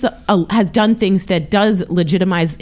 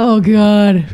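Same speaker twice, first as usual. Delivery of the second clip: lightly acted waking dismay, louder; mock groaning dread; 5 dB louder than usual. People waking to the news, mock groaning dread. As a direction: groan it like a bad morning.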